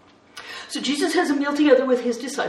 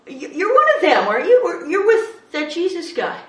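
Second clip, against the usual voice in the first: loud, higher pointed accusation; accusing; 8 semitones higher, 8 dB louder than usual. Acted. Servant girl to Peter, loud accusing voice. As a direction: call him out in front of everyone.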